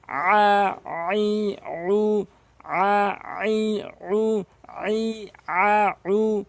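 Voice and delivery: আ, আই, উ chanted in shuffled order; vowel drill. Shown as a demonstration. Teacher drilling vowels for students. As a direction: chant আ আই উ back and forth, drawn out. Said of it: "এভাবে উল্টো সিধা পড়বেন".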